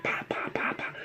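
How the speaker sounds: breathy whispered pa pa gun shots; whispered gun pops. Retold or imitated. Kid showing how the cap gun went, whispered pops.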